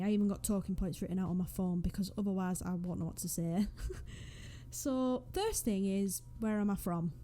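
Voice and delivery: ordinary talk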